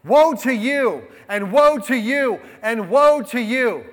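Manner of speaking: preaching woes. Acted. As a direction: thunder out the woes like a prophet.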